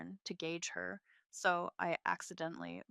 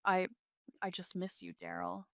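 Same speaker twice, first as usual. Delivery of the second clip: usual speaking voice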